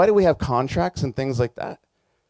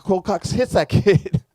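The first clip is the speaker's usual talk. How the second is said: ordinary talk, nothing marked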